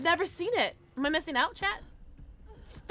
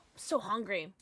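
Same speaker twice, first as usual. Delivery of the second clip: ordinary talk, nothing marked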